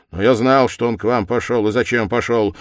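loud, abrupt answer; sharp reply. A narrator's reading. Suspect cutting in on the investigator, sharp. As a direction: answer him sharply and firmly. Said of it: "резко ответил".

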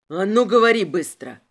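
loud, forceful order; loud interrogator's command; angry demand. A narrator's reading. Inspector demands an answer.

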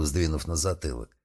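ordinary delivery

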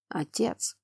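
hoarse, weak call. Acted. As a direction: croak it out from the cell floor. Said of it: "Хриплый голос".

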